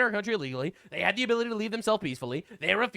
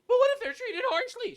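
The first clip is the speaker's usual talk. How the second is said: much higher falsetto voice of a hand-wringing critic; mocking whiny critic. Mimicking an imagined critic, high whiny falsetto. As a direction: squeak it like a fretting do-gooder.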